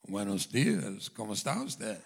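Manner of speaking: put-on low, slow, very loud Spanish greeting, God with a Spanish accent; booming voice of God. God greeting two preachers at the gates, booming Spanish accent. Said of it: "a booming voice cried out and said".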